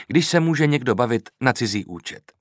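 usual speaking voice

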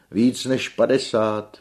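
high, loud, drawn-out boast; grand theatrical outcry; exclaiming tenor. A narrator's reading. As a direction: proclaim it slowly to the gallery. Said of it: "vykřikl Viktor".

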